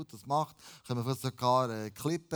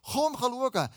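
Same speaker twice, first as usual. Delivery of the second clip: high, urgent beckoning; calling out; 9 semitones higher, 5 dB louder, slower than usual. Acted. Preacher invites the congregation to come, calling out. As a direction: call the listeners over, pitched well up.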